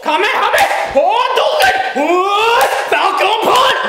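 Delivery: hammed-up anime battle cries; shouted attack names. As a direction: yell each move name like a fighter.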